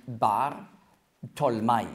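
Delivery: Bar Tolmai as two separate parts, BAR, then TOL-MAI; Aramaic form. Shown as a demonstration. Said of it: "auf Aramäisch".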